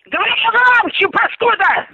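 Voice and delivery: high angry yell down the phone; angry shout. Real, not acted. Furious phone quarrel.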